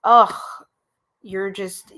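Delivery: ordinary delivery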